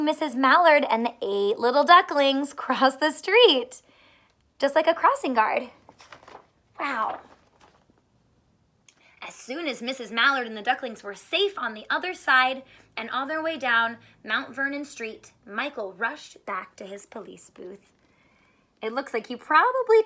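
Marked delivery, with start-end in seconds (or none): none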